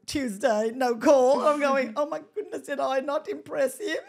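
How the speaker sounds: loud, building mock dismay; mock exasperation. Woman retelling waiting days for his call, mock exasperation. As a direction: count off each disappointment, rising into comic panic.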